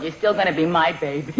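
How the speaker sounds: ordinary delivery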